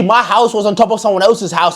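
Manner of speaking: ordinary delivery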